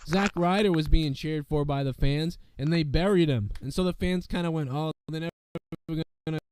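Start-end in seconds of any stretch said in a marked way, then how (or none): none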